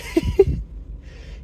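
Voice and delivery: real squeaky burst of laughter; short unvoiced high giggle. Real, not acted. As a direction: laugh high at the absurd moment. Laughs at the scene.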